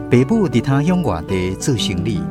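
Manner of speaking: ordinary talk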